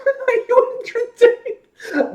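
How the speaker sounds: very high, drawn-out squeal through laughter; slow, high laughing mock quote. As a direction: crack up while squeaking out the line. Laughing at the impression joke.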